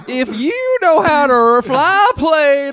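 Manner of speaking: loud, high, slow hammed-up declaiming; country guy parody. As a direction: boom it out like a comedian's catchphrase. Parodies the country-guy line, riffing.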